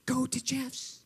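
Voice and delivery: hushed near-whisper; soft, secretive imitated voice; still small voice. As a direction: whisper it as a quiet inner prompting. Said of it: "I'm hearing a still small voice".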